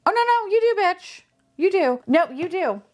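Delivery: squeaky playful back-and-forth; high, bratty playful comeback; playful high teasing. Real, not acted. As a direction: tease it in a high sassy voice.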